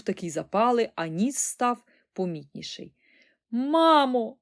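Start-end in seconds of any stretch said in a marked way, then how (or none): 3.5-4.4 loud, high cry to mother; raised, pleading yell; calling out. A narrator's reading. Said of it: "волала пані Бакет".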